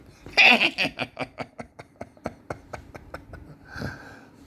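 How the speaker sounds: high, loud burst of laughter trailing down; loud cackle. Real, not acted. Therapist laughs at his cut-off-his-beard joke, loud high cackle. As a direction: cackle at your own joke, long and breathless.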